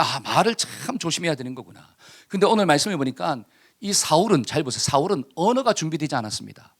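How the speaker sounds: usual speaking voice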